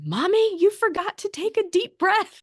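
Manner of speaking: put-on high, bright little boy voice calling mommy out; child's voice correcting his mother; imitating her son. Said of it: "he has said".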